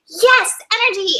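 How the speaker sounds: high, bright "Yes, energy!"; imitating a sudden energy high; a hammed-up cheer of pep; mock burst of energy. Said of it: "you're like".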